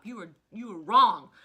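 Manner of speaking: sudden loud blast at the company; loud accusation aimed at the company; loud scolding. Real, not acted. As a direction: call them out, hard and loud.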